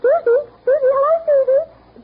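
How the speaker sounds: slow, high, ringing shout of greeting; calling out. Acted. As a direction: hail her from a distance, stretching the name. Calling to a friend nearby.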